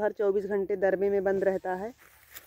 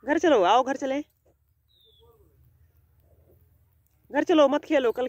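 pleading call; 4 semitones higher than usual. Real, not acted. Owner begging the runaway pet to come home, higher pleading call. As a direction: coax it back, raising your voice.

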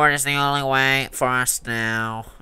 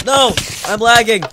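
panicked yelling; 7 semitones higher, 10 dB louder, slower than usual. Real, not acted. Panicking over lag.

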